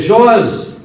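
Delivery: demanding. Retold or imitated. People daring the prophets to prove it, demanding. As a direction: toss it back like a sceptic daring the prophets.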